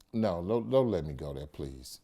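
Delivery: low, pleading and fading to a whisper-soft "please"; pleading father. Retold or imitated. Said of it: "you call and say".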